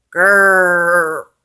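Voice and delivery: loud long growl; werewolf growl. A narrator's reading. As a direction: snarl like a werewolf. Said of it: "says the werewolf".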